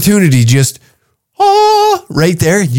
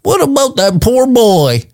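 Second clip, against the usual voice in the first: much higher, whiny concerned voice; mock pearl-clutcher; 9 semitones higher than usual. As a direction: whine it like a fretting do-gooder. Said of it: "we're all so fucking quick to be like".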